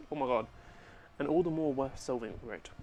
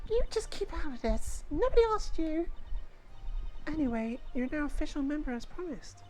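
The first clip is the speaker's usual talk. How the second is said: put-on bossy kid; 13 semitones higher than usual. Kid gang leader bragging at the newcomers, higher bratty voice. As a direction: brag and snap like a little gang leader.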